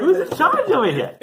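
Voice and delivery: high, loud, laughing cry of who's in charge; a playful, hammed-up outcry over the world's chaos; laughing mock outrage. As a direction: shout it up high through a laugh. Rhetorical exasperation, laughing.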